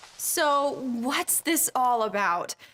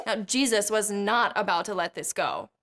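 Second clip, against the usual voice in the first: ordinary delivery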